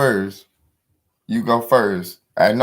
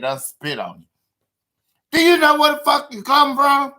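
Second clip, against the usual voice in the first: high-pitched Big Mama scolding, rising to a yell; high preacher-grandma voice that climbs to shouting; Big Mama preaching; 7 semitones higher than usual. Acted. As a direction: preach down at him as the old church mother. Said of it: "you done heard Big Mama preach".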